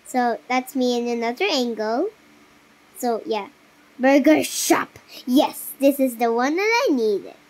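ordinary delivery

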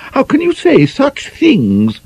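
high, slow, scandalised protest; shocked prim lady. Acted. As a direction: clutch your pearls, drawn out. Gwynifred shocked.

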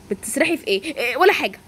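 normal talking voice

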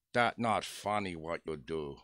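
slow, low mimicked warning; low, drawn-out imitation of an offended friend; King Wang. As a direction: imitate a broken-English rebuke, word by word. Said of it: "that's exactly how he said it".

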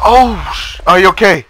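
high startled cry; panicked call to a hit friend; alarm shout. Real, not acted. Teammate hit by explosion.

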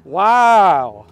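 loud, amazed wow; be blown away by it. Real, not acted. Reacting to big weight.

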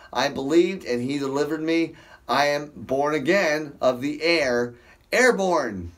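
ordinary delivery

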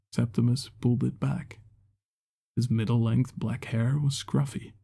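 ordinary delivery

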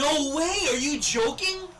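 high, loud shout of disbelief; can't believe it, shout it; disbelieving shout. Real, not acted. Reacts to goal against.

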